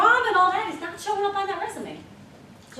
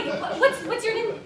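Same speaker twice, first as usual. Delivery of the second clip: usual speaking voice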